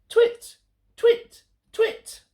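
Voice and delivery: very high owl twitter; owl calling. Acted. Olive calling again for a friend. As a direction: call out like a little owl. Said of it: "called Olive".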